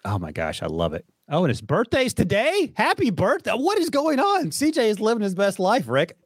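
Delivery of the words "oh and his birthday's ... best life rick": excited birthday surprise. Real, not acted. To co-host on learning it's his birthday, excited high exclamations. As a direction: gush over the news with real glee.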